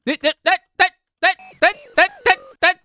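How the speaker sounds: high, loud staccato bursts; stuttered shouting. Pretend. Player fending off demon in game, high stuttered shouts. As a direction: fire the word out in repeated yelps.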